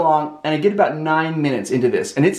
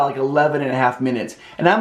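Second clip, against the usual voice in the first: ordinary talk, nothing marked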